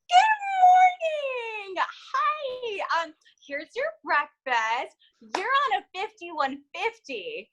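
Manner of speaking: high, slow, syrupy nurse voice; sugary nurse. Retold or imitated. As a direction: sing it sweetly like a cheerful nurse. Imitating sweet nurse.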